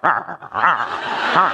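low, drawn-out little dog growl; mock dog noises amid laughter; dog imitation. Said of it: "the dog was like".

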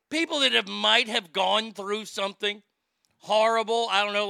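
ordinary talk

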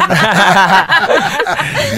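high, drawn-out laughing fit; burst of laughter. Real, not acted. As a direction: crack up at being teased. Laughing at the teased nickname.

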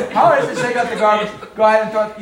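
loud, fast, exasperated jab; loud scoffing retort; mocking annoyance. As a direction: throw it back at her, irritated.

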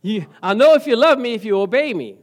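teasing imitation of a wife; quoting his wife. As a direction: playfully voice the wife laying down the rule. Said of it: "That's what my wife says".

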